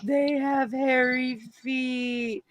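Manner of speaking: a hint of a hurt little girl; imitating her little daughter. Mother quoting her upset daughter, slow mock-whiny child.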